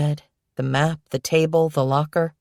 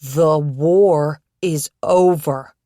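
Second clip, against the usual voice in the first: The war is over, drawn out slowly and louder; slowly, word by word; 4 dB louder, much slower than usual. Acted. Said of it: "I'll say it slow so you understand".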